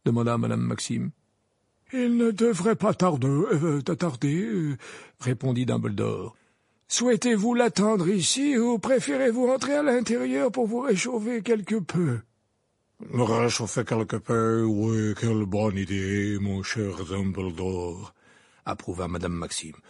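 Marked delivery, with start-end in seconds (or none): 1.9-4.8 put-on much higher, hesitant old man; old wizard voice. Dumbledore voiced. 6.9-12.2 put-on much higher, courtly old man; old wizard voice. Dumbledore voiced. 13.1-18.1 lower, slow grand lady. Acted. Madame Maxime voiced.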